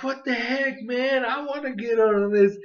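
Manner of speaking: high-pitched mock protest; indignant. Jealous Pokemon demanding to join the dance, indignant. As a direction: act out an indignant little complaint. Retelling an indignant character's complaint.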